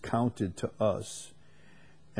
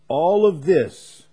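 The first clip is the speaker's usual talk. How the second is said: loud, slow emphatic opening; 6 semitones higher, 9 dB louder, slower than usual. Real, not acted. Preacher declaiming to the congregation, loud and slow. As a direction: ring it out to the hall.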